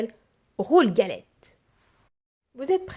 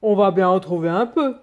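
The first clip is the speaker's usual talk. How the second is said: put-on louder gruff old man voice; old husband, firmly and louder; 7 dB louder than usual. A narrator's reading. Old man telling his wife to look, louder old man voice.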